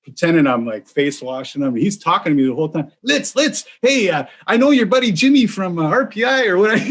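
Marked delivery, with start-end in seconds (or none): 3.0-6.8 put-on high, rushed nervous college kid voice; nervous babble. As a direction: squeak it out like a panicked kid. College guy babbling during fight.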